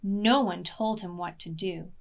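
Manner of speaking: usual speaking voice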